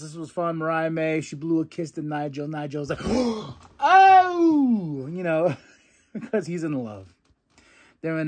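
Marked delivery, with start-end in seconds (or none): 3.0-5.1 sharp gasp into a high "Oh!"; high, louder smitten gasp; lovestruck gasp. Retold or imitated. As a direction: gasp like someone just got a kiss blown at him. Nigel reacting to blown kiss.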